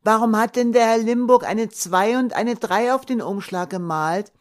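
put-on small boy quizzing his busy father; curious child's voice. A narrator's reading. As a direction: play a five-year-old full of questions. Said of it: "Thomas' helle Stimme".

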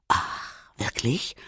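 soft breathy whisper. Acted. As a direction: breathe it, barely voiced. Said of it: "flüsterte Leon".

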